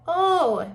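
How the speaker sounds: high, loud, round "oh"; wide-open "oh" demo. Shown as a demonstration. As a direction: drop the jaw on a loud "oh". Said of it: "See how open my mouth is".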